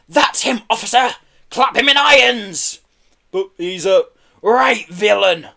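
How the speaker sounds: high, loud, vindictive snarl. A narrator's reading. Pelly accusing Kelly to an officer, snarling. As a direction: denounce him with relish. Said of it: "snarled Pelly".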